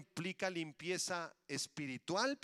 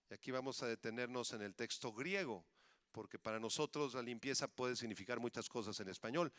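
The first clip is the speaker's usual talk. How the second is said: normal talking voice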